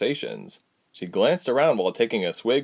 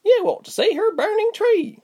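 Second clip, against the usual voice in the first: put-on much higher eager girl's voice; excited; 3 dB louder than usual. A narrator's reading. Berg's sister telling the guests about the tree, excited. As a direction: burst out eagerly. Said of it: "Berg's sister said excitedly".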